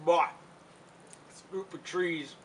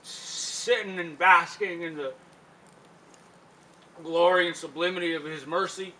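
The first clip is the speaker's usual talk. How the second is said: grand testimony; 5 dB louder than usual. Acted. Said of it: "is what he used to say".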